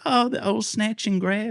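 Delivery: drawn-out Australian impression of a guest; Australian accent. Host imitates the Australian guest's reply in a high, slow Australian voice. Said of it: "And he's like".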